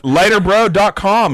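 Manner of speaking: high, drawn-out ad shout; hype sponsor shout. Acted. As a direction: belt out the sponsor name like a hype man.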